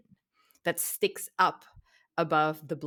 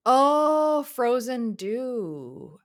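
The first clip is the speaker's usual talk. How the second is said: surprised; 7 semitones higher, 6 dB louder, much slower than usual. Real, not acted. Realising what is meant.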